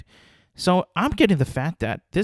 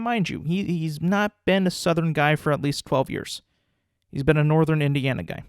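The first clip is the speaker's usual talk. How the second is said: ordinary talk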